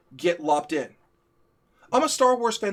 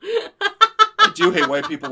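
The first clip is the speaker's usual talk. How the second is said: hammed-up, slow, much higher and louder; mock confession; 10 dB louder, much slower than usual. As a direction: play it as a big joking admission. Mock confession to tease partner.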